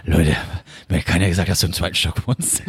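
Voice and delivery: father's voice. Retold or imitated. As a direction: quote his dad in a low voice. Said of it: "Das waren original seine Worte".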